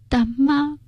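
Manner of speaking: put-on high faint child's call; dying child calling out. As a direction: call out softly like a dying boy. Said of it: "清晰地喊了一声".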